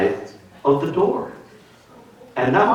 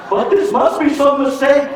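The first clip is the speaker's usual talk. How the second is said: high, louder desperate plea; a detainee behind a door begging, high and loud; pleading detainee; 12 semitones higher, 5 dB louder than usual. Retold or imitated. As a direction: wail it like someone being hauled off. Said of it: "you can hear the phrase".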